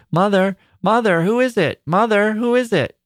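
put-on a frightened little girl calling down to her mother; girl upstairs calling to her mother in the night; calling shrilly. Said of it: "the girl's voice upstairs calling shrilly".

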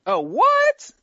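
a squeal of high surprise; mock surprise. Told the Bengals go first, a high shocked squeal. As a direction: jump up high in fake shock.

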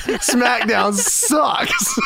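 high, drawn-out mock shout; mock heckling shout. Wrestler insulting SmackDown backstage, mock heckling shout. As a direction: stretch the insult out, half laughing. Retells Booker's insult, hammed up.

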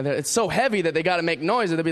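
usual speaking voice

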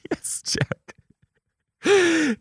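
high, fond sing-song; teacher voice to a child. Retold or imitated. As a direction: answer gently like to a little kid. Answering child fondly.

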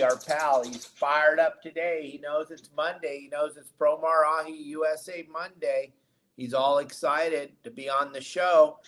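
ordinary delivery

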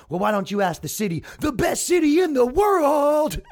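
hammed-up crowd-pleasing yell. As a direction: play to the crowd, stretching the last words. Movie hero crowing to the press.

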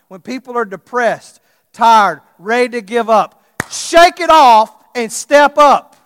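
loud, high, fervent preacher's call; preaching at full force. Acted. Pastor urging his church, preaching full force. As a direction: drive it home to the congregation.

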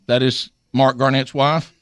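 usual speaking voice

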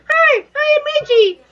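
put-on generic anime girl. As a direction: squeak it like a cartoon heroine. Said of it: "the anime chick, um, generic".

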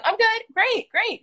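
high, chirpy brush-off pleasantry; fake-cheery small talk. Fake small talk in grocery store.

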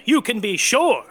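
normal talking voice

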